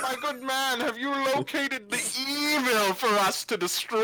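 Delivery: hammed-up noble champion; eager crusading sword. Player voices the evil-hunting sword.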